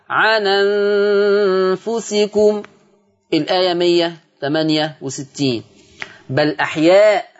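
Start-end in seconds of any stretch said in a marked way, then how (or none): 0.1-2.6 عن أنفسكم with open nun, ʿanenfusikum; transferred vowel. Shown as a demonstration. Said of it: "هتبقى النون مفتوحة".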